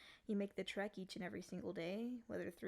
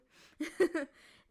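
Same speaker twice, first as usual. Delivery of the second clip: sudden loud eerie laugh; creepy laugh; 7 semitones higher, 13 dB louder than usual. Acted. Demon laughing after saying it stares at your bedside. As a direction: burst into an unsettling giggle.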